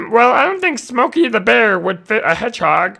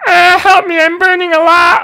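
mock cry for help; 8 semitones higher, 8 dB louder than usual. Hedgehog on fire.

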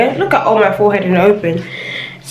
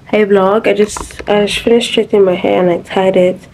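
usual speaking voice

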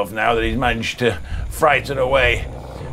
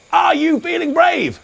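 calling it out again, high and louder; louder, higher repeat. Shown as a demonstration. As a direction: ask again with more energy. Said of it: "I said".

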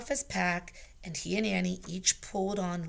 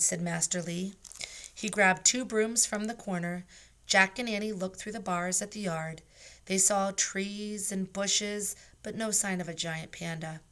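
ordinary delivery, nothing marked

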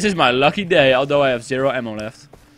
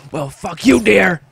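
angry yell; 3 semitones higher, 5 dB louder, slower than usual. Real, not acted. Gamer yelling at fleeing deer.

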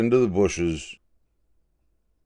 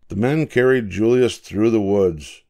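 ordinary delivery, nothing marked

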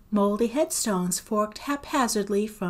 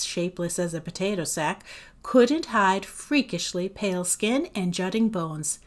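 usual speaking voice

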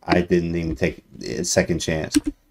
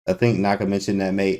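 ordinary delivery

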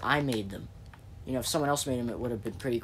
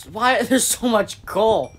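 higher, louder, slow amazed outburst with a laugh; surprised; 10 semitones higher, 12 dB louder, slower than usual. Real, not acted. Gamer stumbling on a huge coal vein, laughing amazement. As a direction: blurt it out in laughing disbelief.

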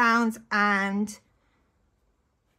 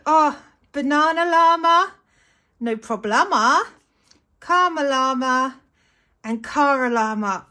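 louder, sing-song reading of silly names; playful delight; 3 semitones higher, 6 dB louder than usual. Real, not acted. Reading llama stickers to viewers with playful delight. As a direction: relish each silly llama name.

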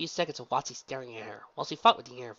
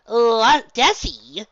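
smirking; 11 semitones higher, 16 dB louder, much slower than usual. Acted. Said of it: "said with a small smirk".

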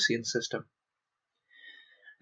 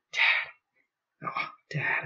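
anguished cry; much slower than usual. A narrator's reading. Bart grieving his dead father.

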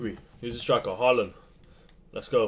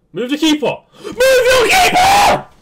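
high, loud shout breaking into a laugh; laughing scream; 13 dB louder, slower than usual. Real, not acted. Gamer screaming at the opponent to move his keeper, laughing shout. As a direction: scream it in disbelieving glee.

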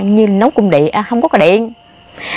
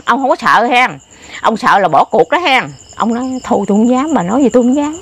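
usual speaking voice

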